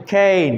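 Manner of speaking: কেন as a drawn-out "kaayn"; long A. Shown as a demonstration. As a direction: hold the "ay" in কেন. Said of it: "long এ". (কেন, এ টেনে লম্বা করে)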